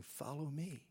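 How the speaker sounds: quiet, gentle invitation "Follow me"; hushed, simple "Follow me"; soft hushed "Follow me". Retold or imitated. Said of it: "He just keeps saying".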